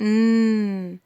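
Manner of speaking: ن held long and nasal; N through the nose. Shown as a demonstration. Teacher asking pupils to notice N, nasal held sound. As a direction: sustain "nnn" on ن from the nose. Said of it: "ال ان بتطلع من الأنف".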